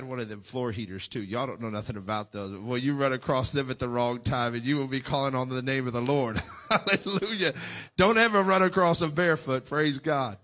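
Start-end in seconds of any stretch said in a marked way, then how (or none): 2.6-10.4 laughing preach. Real, not acted. Joking about floor heaters, laughing.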